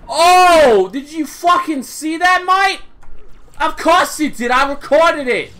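excited bragging. Real, not acted. Bragging about a great play.

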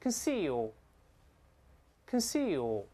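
conceal very slowly, weak "kən" then long "seal"; light first syllable. Shown as a demonstration. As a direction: model conceal twice with a long pause between. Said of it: "注意在后面的前面轻读".

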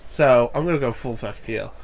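ordinary delivery

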